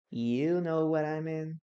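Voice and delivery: slowed down, word by word; slow, deliberate repeat for the students; slow dictation repeat. Shown as a demonstration. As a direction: stretch it out so they can write it. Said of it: "Listen and write".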